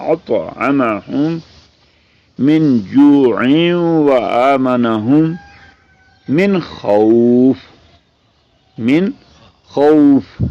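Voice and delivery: slow Quranic chant, rising on الَّذِي; teacher reciting the verse for students; Quran recitation. Acted.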